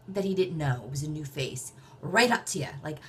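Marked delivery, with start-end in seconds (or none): none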